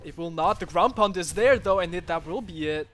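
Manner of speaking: loud hype call of the kill; excited shout. Real, not acted. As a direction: shout it as the finisher lands. Commentator calls the kill.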